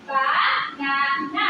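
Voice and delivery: "banana" slowly split as ba-na-na; counted by syllables. Shown as a demonstration. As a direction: count out the three beats of "banana". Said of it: "You have to count it".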